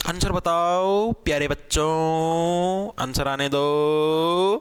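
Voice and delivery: very slow, low, drawn-out coaxing; sing-song drawl. Real, not acted. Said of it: "teasing sing-song to students". (बहुत धीमे, खींचकर बच्चों को चिढ़ाते हुए)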